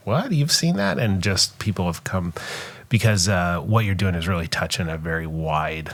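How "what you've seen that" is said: high-pitched retold disbelief; surprised reaction. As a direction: mimic your own astonished double take, pitch jumping up. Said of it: "I'm like".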